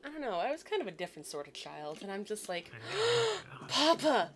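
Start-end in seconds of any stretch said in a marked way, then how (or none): 2.7-3.6 sharp high gasp of mock horror; shocked gasp. Five-year-old catches dad feeding alligators, gasping. 3.7-4.3 put-on high, slow, scandalized child; tattling five-year-old. Five-year-old threatens to tell on papa, indignant. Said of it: "me being five".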